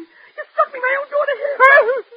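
very high wounded wail; wailing accusation. Acted. Mother struck by her own daughter, wailing accusation. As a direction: let the hurt climb into a high tearful cry.

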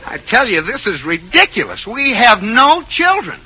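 high, insistent denial; exasperated protest. Acted. Husband insists to doctor there are no children, exasperated.

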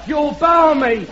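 ordinary talk, nothing marked

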